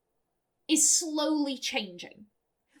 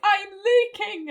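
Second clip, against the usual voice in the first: slow, higher, hammed-up teary voice; mock tearful; 6 semitones higher, 7 dB louder, slower than usual. Jimena finally moved to tears, mock teary. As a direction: pretend to well up, slowly. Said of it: "and she's crying".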